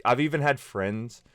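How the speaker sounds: usual speaking voice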